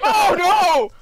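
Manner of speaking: high, loud outburst; alarmed exclamation. Real, not acted. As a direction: cry out in alarm at the reveal. Reacts to terrifying reveal.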